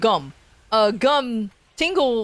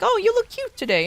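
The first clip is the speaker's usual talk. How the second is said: high mock compliment; 12 semitones higher than usual.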